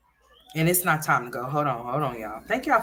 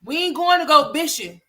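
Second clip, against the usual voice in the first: mock motherly correction; 11 semitones higher, 6 dB louder than usual. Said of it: "I'll be like".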